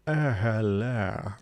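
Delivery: very slow, drawn-out posh hello. Shown as a demonstration. Streamer showing chat how to sound posh. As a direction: stretch the hello out like a toff. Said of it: "If you wanna sound posh, say".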